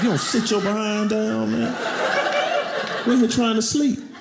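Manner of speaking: high, exasperated scolding; mock scold the early-waking kid; annoyed dad. Dad scolding son at six a.m., exasperated. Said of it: "I'm like".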